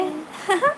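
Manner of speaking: short rising giggle; real chuckle. Real, not acted. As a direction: give a quick, high little giggle. Amused after exclaiming. (हल्की, चढ़ती खिलखिलाहट)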